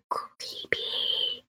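soft, voiceless stretched whisper; silently mouthed word. Acted. Keva saying it without actually saying it, whispered. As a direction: whisper it long and drawn out, barely aloud. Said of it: "she says without actually saying it".

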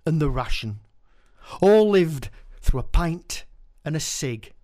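stage poetry reading; poem recital. Acted. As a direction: perform the verse with relish. Performs his poem.

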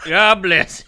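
put-on Brooklyn-Tennessee twang, old man; old prospector accent. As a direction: repeat it in the old prospector drawl. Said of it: "Like an old prospector?".

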